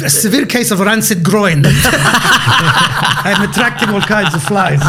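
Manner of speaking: slow words breaking into laughter; laughing through it. Real, not acted. Comedian laughs through his own fly joke. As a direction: fight the giggles while finishing the joke. Joke about attracting flies.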